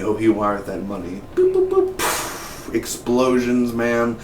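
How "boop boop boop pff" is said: high little beeps, then a blown-out explosion; imitated bomb beeps and blast. Wired money blowing up, imitated sound effects. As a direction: mouth a countdown and a puffed blast.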